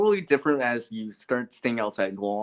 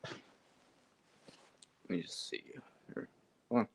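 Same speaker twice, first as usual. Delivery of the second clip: muttered whisper to himself; 5 semitones lower, 15 dB quieter, much slower than usual. Real, not acted. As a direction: breathe it out under his breath. Muttering to himself while busy.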